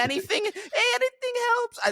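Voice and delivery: high-pitched, put-on Irish beggar; Irish accent. Beggar asking passers-by for coins, mock Irish accent. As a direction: imitate a begging voice in a bad Irish accent. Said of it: "I don't know why I'm Irish".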